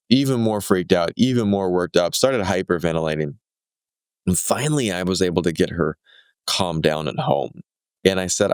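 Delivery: ordinary delivery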